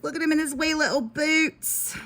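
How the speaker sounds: a clumsy, high attempt at a Scottish accent; bad Scottish accent. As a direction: put on a faltering Scottish lilt. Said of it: "I have horrible accents".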